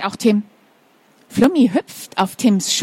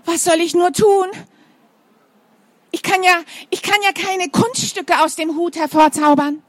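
despairing teacher; 5 semitones higher than usual. Acted. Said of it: "ruft Frau Jansen".

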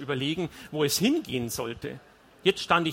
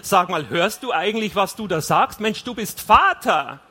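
raised, incredulous scolding; loud exasperation; 4 semitones higher, 8 dB louder than usual. A narrator's reading. Wife reminds husband he is a father, loudly exasperated. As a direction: drive it up to a loud final word.

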